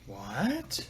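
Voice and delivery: low, soft start sweeping up into a question; a drawn-out, quietly incredulous question; baffled disbelief. Real, not acted. Baffled by an unexpected name.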